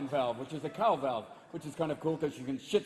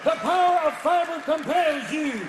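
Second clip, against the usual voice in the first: even slower, louder ritual chant; exorcist command slower; 9 semitones higher, 7 dB louder, slower than usual. Acted. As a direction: drag out the decree. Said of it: "priest to do a rectal exorcism".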